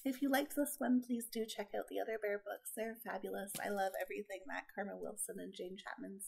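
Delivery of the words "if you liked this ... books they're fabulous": usual speaking voice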